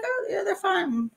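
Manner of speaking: high, slow, strained politeness; high, slow, awkward polite answer; forced polite reply. Retold or imitated. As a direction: answer sweetly while hiding disbelief. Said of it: "I'm like".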